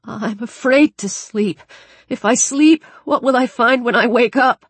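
high-pitched, frightened voice; shuddering fear. Acted. Shuddering Daniel tells Alex he fears sleep, frightened. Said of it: "He was shuddering as if".

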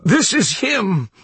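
very high, drawn-out acted wail; sobbing cry. A narrator's reading. Reverend pointing out the devil to his flock, sobbing cry. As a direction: wail it slowly in despair. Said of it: "cried the Reverend, sobbing".

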